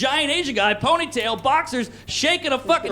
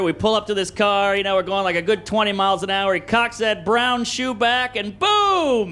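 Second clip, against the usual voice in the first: ordinary talk, nothing marked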